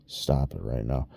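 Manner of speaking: quietly scolding yourself. Retold or imitated. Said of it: "it's just like".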